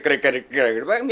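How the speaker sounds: ordinary talk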